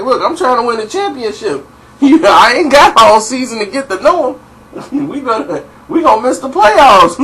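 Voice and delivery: mock outrage breaking into laughs. Voicing [name] ranting, laughing.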